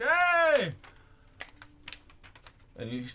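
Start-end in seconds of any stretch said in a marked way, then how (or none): 0.0-0.7 sudden loud call across the market; shouted hey. Acted. Newsboy calling out, a sudden loud hey. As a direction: yell it out to get attention. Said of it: "and you're like".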